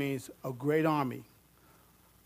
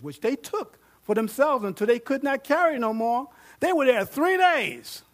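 higher and louder, building wonder; excited preaching; 6 semitones higher, 6 dB louder than usual. Acted. Preacher telling the congregation of the spoils, excited. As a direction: marvel at the spoils.

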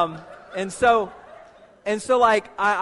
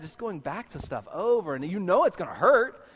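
ordinary talk, nothing marked

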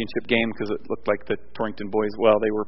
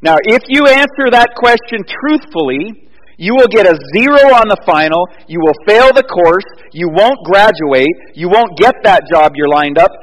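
loud, high, driving preacher's warning; preaching at full force; 8 semitones higher, 10 dB louder than usual. Acted. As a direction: hammer the consequences home from the pulpit. Preacher poses a harsh scenario.

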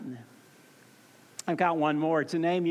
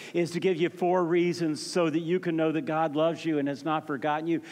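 ordinary talk, nothing marked

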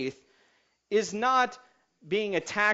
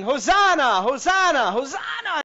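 high, loud acclaiming cries; crowd shouting; 8 semitones higher, 9 dB louder than usual. Acted. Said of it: "everyone was shouting".

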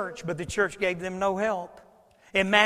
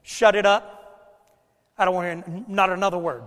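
a hint of a strict father; dad's command; 2 semitones lower, faster than usual. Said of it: "when my dad said that".

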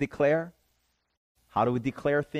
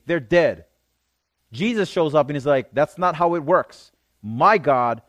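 loud, raised preaching voice; forceful preaching; 3 semitones higher, 7 dB louder than usual. Real, not acted. Preacher tells youth God decides life and death, forceful preaching. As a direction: drive each phrase home with mounting conviction.

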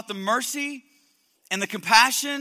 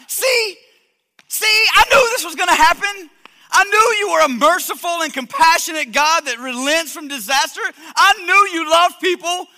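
loud, higher, exasperated rant at God; angry Jonah; 5 semitones higher, 8 dB louder than usual. Acted. Preacher voicing Jonah yelling at God in anger. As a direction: fume at God like a sulking prophet. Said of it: "look at his response to God".

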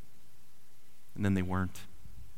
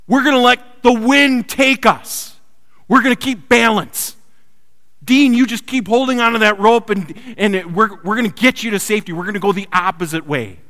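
calling out; 8 semitones higher, 8 dB louder than usual. Retold or imitated. Brothers calling plan in storm.

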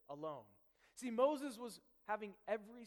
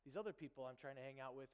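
brushing off; 5 semitones lower, 6 dB quieter, faster than usual. Retold or imitated. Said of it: "I'd say, like".

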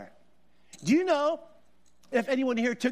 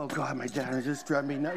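low, softer grumble to himself; exasperated aside; 10 semitones lower, 4 dB quieter than usual. Retold or imitated. Preacher as his teenage self embarrassed by dad, exasperated aside. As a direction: drop it low, a fed-up son's aside.

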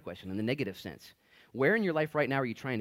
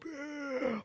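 mock gasp. Mimics crowd gasping.

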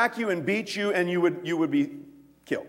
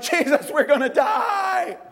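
higher, slow, shouted panic; panicked disciples; 8 semitones higher, slower than usual. Acted. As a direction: cry out over the storm in fear.

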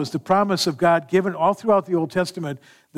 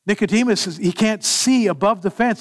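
usual speaking voice

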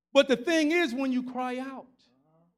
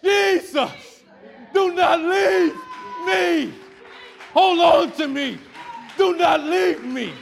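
loud, high, pleading cry; 9 semitones higher, 12 dB louder, slower than usual. Acted. Believer begging Jesus not to leave, crying out. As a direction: call desperately to Jesus with drawn-out words. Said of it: "I'ma cry out and say".

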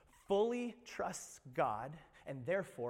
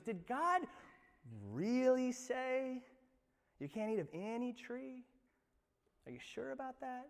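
sly tempter; 8 semitones higher than usual. Acted. Satan voiced tempting Eve.

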